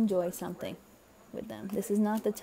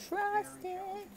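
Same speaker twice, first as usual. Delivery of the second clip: high, lilting little coo; playful high voice; 11 semitones higher than usual. Real, not acted. Playful high repeat of phrase.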